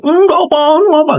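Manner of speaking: high pleading student voice; voicing the lying student; student's denial. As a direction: squeak out the kid's denial.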